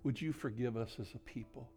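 soft, reverent praying with long pauses; quiet, pleading pastoral prayer; hushed prayer. Real, not acted. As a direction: speak intimately to God, nearly whispering at times. Leads the congregation in prayer.

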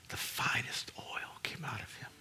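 voiceless hushed whisper; awed whispered line. Real, not acted. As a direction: drop to a breath. Sudden hush after shouting.

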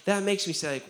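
ordinary talk, nothing marked

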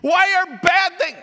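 high, hammed-up wail to heaven; crying out. People demanding answers from God, crying out loudly. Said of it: "then we start crying out".

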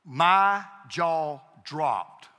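very slow, loud, word by word; dramatic emphasis. Real, not acted. Preacher tells congregation he was stunned, dramatic emphasis. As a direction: land each word of "my jaw dropped" heavily.

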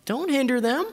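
high-pitched annoyed order; indignant command. Acted. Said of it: "it says that he was indignant".

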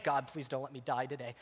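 low, soft retold plea; murmured prayer.

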